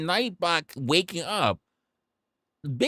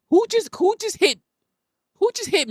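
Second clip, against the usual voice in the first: surprised; faster than usual. Real, not acted. Reacting to chat in surprise.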